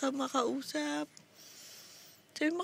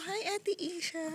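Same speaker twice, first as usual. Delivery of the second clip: put-on soft, higher voice for the baby; speaking for baby; 5 semitones higher, 7 dB quieter than usual. Parent coaching baby to greet, soft baby-talk. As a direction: prompt the baby with a gentle, high hello. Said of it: "Sabi mo".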